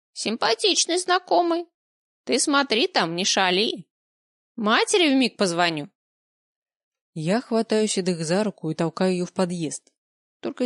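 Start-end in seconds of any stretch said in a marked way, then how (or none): 0.1-5.9 put-on high, sly old granny voice; teasing old woman. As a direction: wag a finger like a granny.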